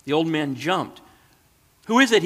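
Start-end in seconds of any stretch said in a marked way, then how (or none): 1.9-2.2 high, loud startled cry. A narrator's reading. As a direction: jump awake and cry out. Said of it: "he cried".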